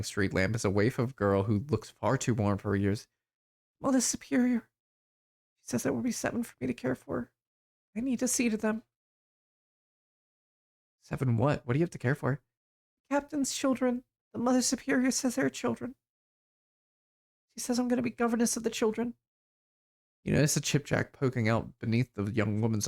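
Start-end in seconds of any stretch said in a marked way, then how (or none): 3.8-8.8 put-on high, small, distant girl's voice; dazed waif girl. 13.1-19.1 put-on high, small, distant girl's voice; dazed waif girl.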